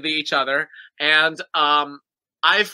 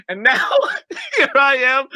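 laughing; 6 semitones higher, 3 dB louder than usual. Real, not acted. Laughing mid-verse.

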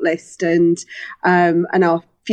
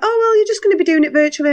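quick, higher mock-breezy voice; mocking people; 9 semitones higher, much faster than usual. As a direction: toss it off like a know-it-all. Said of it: "people are like".

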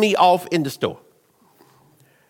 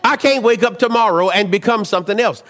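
ordinary delivery

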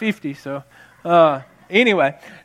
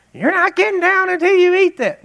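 high, loud mock demand; shrill-free sharp order to a toddler; stern mom; 13 semitones higher, 4 dB louder than usual. Mother ordering toddler to stay at table, loud. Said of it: "you didn't use that tone".

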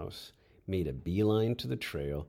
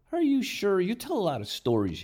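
incredulous doubt; 13 semitones higher, 6 dB louder than usual. Acted. Doubting friend's tall tale.

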